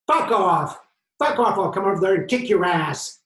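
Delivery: loud, tough-guy shouting; mock-macho threat yelled across the street; butch routine. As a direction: puff up and bark like a tough guy. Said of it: "My butch routine".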